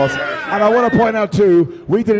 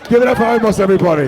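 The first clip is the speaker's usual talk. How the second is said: calling out; 3 semitones higher, 4 dB louder, faster than usual. Real, not acted. Host calling out to crowd.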